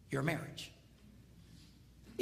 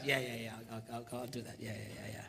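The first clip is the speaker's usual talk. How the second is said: dismissive mumble; 9 semitones lower, 8 dB quieter than usual. Retold or imitated. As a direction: lower and softer, brushing it off. Husband brushing off wife.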